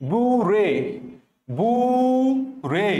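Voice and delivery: "বুড়ে" stretched slowly into boo and ray; slow emphatic repeat of "বুড়ে", syllables pulled apart; drawn-out syllable drill. Shown as a demonstration. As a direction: hold the first syllable of "বুড়ে" long. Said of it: "চিন্তা করো কোথায় আছে বুড়ে".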